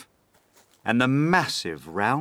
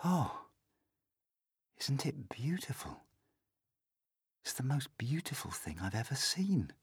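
put-on soft, higher, wondering boy; quiet murmur; 4 semitones higher, 10 dB quieter than usual. A narrator's reading. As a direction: murmur to yourself, spellbound. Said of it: "murmur quietly to himself".

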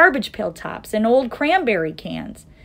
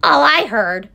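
loud, higher, scornful snap; snappish; 6 semitones higher, 7 dB louder than usual. A narrator's reading. Kangaroo denies hearing the Whos, snapping. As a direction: snap back in dismissal. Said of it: "snapped the big kangaroo".

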